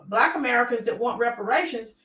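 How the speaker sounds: normal talking voice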